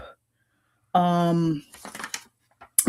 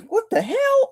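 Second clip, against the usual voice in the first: much higher, incredulous squeak; mock disbelief. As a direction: sound baffled and amused. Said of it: "I'm like".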